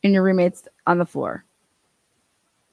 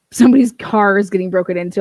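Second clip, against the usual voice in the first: normal talking voice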